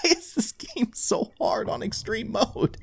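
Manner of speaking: soft, whispery whine; whining complaint. Real, not acted. Player losing on extreme mode, whining softly. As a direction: whimper a complaint, voice nearly gone. Whining after being caught.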